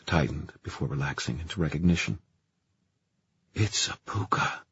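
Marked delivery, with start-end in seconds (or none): 3.5-4.6 hushed aside; under breath. Acted. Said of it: "he said under his breath".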